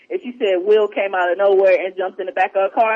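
ordinary delivery

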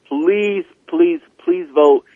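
drawn-out high pleading please. Real, not acted. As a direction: beg them slowly to go vote. Urging listeners to vote.

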